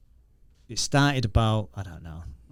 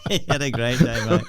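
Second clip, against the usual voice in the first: words half laughed out; chuckling about the dog's great day; laughing; 3 semitones higher than usual. Real, not acted. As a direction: laugh through the line.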